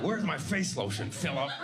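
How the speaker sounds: mom's complaint. Retold or imitated. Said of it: "She's like".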